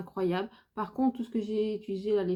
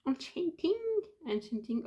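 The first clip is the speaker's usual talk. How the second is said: English word in a French accent; 8 semitones higher than usual. Real, not acted. Vlogger trying an English shade name, French accent. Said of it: "mon accent anglais".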